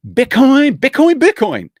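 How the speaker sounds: high, loud excited chant; gushing, thrilled outburst. Real, not acted. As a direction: shout the word like a cheering fan. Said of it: "I get a little excited sometimes".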